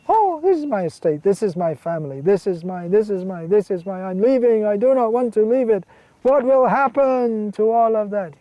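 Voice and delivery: hammed-up wail of someone losing everything; mock crying. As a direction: raise the pitch into a theatrical lament. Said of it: "And others there crying".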